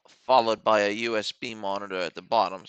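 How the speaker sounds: normal talking voice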